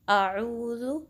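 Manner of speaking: आऊज़ु with a crisp glottal hamza at the start; sharp hamza. Shown as a demonstration. Teacher shows students the correct आऊज़ु with a sharp hamza. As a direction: clip the opening of आऊज़ु with a clean throat stop. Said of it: "हमज़ा को सही से pronounce करना है".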